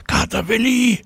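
high excited call; girl calling her cat from the air, high and excited. A narrator's reading. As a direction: call the cat from up near the ceiling. Tina calls the cat.

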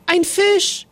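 high, thrilled cry of discovery; excited shout. A narrator's reading. Big brother spotting the fish, shouting excitedly. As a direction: yell it out, bursting with excitement. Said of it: "schrie Eri".

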